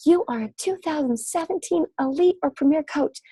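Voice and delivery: high, bright announcer call; mock announcer unveiling the winner; big announcement. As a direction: proclaim it like a game show host. Announcing the elite winner on Zoom.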